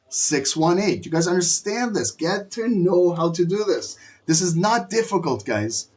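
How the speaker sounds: ordinary talk, nothing marked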